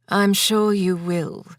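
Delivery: slow, sly and knowing, a smile in the voice. A narrator's reading. Husband slyly answers his wife's promise, slow and knowing. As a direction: draw it out with a sly grin. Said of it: "he said with a sly smile".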